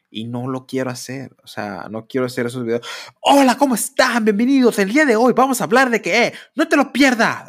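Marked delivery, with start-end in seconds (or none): 3.2-7.5 youtuber exagerado, muy agudo y fuerte; voz de youtuber. Retold or imitated. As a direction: saludo de influencer sobreactuado, agudo y a todo volumen. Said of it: "Así, no, no quiero hacer eso". (imitación burlona de youtuber, aguda y fuerte)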